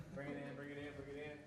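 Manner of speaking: softly, pulling the group close; quiet huddle talk; hushed huddle voice. Real, not acted. Huddle before the cheer.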